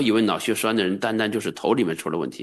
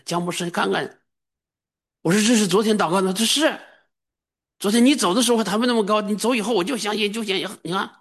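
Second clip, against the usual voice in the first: much higher, fast and thrilled; elated patient telling the pastor; delighted patient; 11 semitones higher than usual. Retold or imitated. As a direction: show off the healed arm with glee. Voices the healed patient rejoicing.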